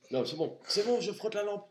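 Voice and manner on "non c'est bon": ordinary delivery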